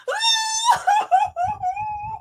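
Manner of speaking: high whimpering wail; high sobbing wail; wailing cry. Acted. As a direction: cry out like a hurt cat. Lion cries after bite.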